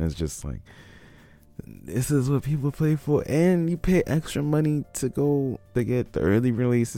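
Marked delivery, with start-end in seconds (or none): 1.7-7.0 much higher-pitched incredulous complaint; high, exasperated rant; exasperated disbelief. Real, not acted. Ranting about paying for bad game.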